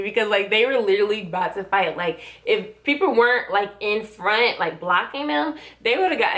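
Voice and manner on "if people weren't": ordinary talk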